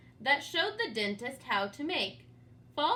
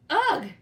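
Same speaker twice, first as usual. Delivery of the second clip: loud, high shriek of disgust; 5 semitones higher, 10 dB louder than usual. Acted. George shrieking as his false teeth fly out. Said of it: "George shrieked".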